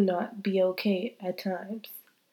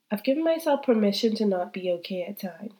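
normal talking voice

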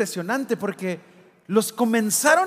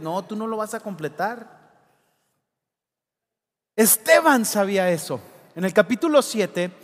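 ordinary talk, nothing marked

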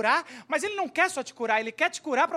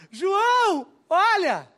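excited call out; 7 semitones higher, 8 dB louder, much slower than usual. Acted. Healed man calls out to priest.

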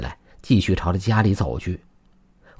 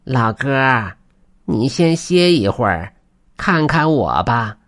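high, slow creature voice; put-on animal-spirit hail; weasel calling out; 5 semitones higher, slower than usual. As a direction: call gently from behind, drawing each phrase out. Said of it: "忽然身后传来呼唤声".

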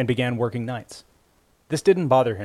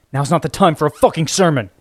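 louder, angry snap; snapped; 5 semitones higher, 8 dB louder than usual. A narrator's reading. Grieving grandson snapping at Florence, angry. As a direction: bite her head off. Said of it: "he snapped".

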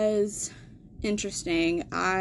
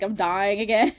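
mock crying. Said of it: "And I was crying".

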